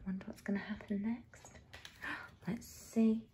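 very soft, quick aside; near-whisper that builds suspense; hushed suspense. A narrator's reading. Reader to children wondering what comes next, hushed.

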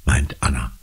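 plain reading voice

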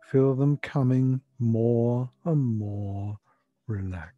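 ordinary talk, nothing marked

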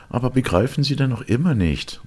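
high, agitated exclamation; exasperated cry. A narrator's reading. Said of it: "rief er".

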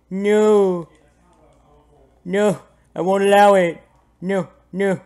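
high, loud bursts of refusal; mock defiant cry. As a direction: refuse loudly in playful protest. Playfully resisting being carried away.

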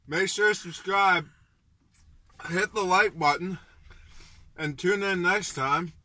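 burning mouth. Real, not acted. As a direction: push the outro through a burning mouth. Mouth burning from hot pepper.

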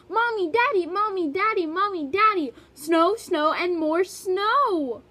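put-on excited child shouting, loud and high; loud high excited yell. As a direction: call out to the parents in a panic. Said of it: "Olivia ran to the kitchen and yelled".

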